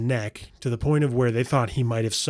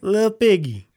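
Lil Piggy sung out higher and louder; cutesy nickname voice; 8 semitones higher, 6 dB louder, slower than usual. Acted. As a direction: coo Lil Piggy like a pet name. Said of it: "also known as Lil Piggy".

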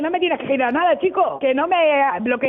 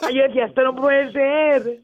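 slow, laughing relief; relieved laughing confession. Real, not acted. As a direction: tell it through real laughter, slowly. Victim laughing at the prank.

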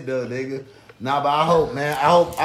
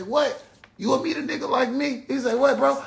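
mock indignant cousin; 11 semitones higher, 4 dB quieter than usual.

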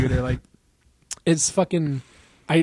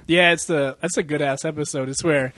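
ordinary talk, nothing marked

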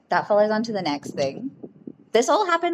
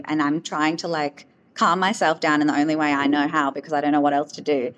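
ordinary delivery

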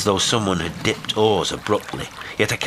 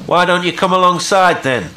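loud, high, irritated call; somewhat snappish; 12 semitones higher, 9 dB louder than usual. A narrator's reading. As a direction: fire the question over the rail, a touch testy. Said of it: "I queried somewhat snappishly".

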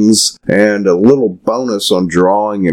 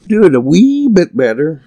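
mock high voice; 10 semitones higher, faster than usual. Mock voicing the doctor.

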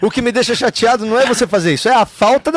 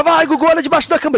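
family urging; 8 semitones higher than usual. Retold or imitated. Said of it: "todo mundo fala".

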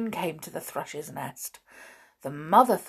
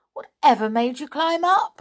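pixie; 7 semitones higher, 6 dB louder than usual. A narrator's reading. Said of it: "asked the pixie".

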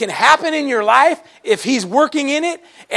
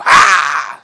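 mock anointing shout; 5 semitones lower, 5 dB louder than usual. Laying hands on someone.

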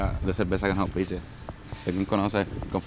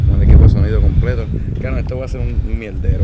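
loud, raised voice over wind noise; shouting over wind; 11 dB louder than usual. Real, not acted. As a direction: shout to be heard outside. Outdoors, wind noise, recording.

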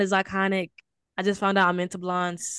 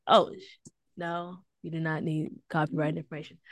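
ordinary talk

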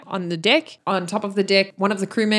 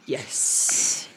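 whisper; 8 semitones lower, 6 dB quieter than usual. Real, not acted. Whispered agreement.

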